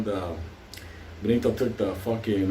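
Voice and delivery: ordinary talk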